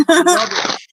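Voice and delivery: hammed-up London accent, high and loud; loud, high mock London accent; mock British "Rubbish". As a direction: toss out "Rubbish" like a mock Cockney. Mocking British slang, high and loud.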